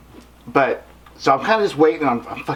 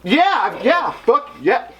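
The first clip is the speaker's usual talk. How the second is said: high, fumbling yeses; tongue-tied fan babbling; nervous fan; 6 semitones higher, much slower than usual. Retold or imitated. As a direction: grab at words, starstruck. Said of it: "And I'm".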